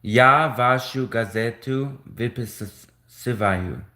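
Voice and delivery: Я вашу газету выписываю read slowly, syllable by syllable; slow careful Russian. Shown as a demonstration. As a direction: sound out each Russian word with care. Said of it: "I was trying to say that in Russian".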